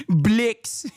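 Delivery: mock Afrikaner vowel, through giggles; South African accent. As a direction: say it in a hammed-up South African accent while laughing. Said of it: "Was that a good South African accent".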